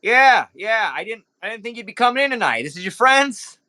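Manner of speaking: nervous club owner. Acted. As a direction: cover the awkwardness with chatter. GM voices Nero Pink.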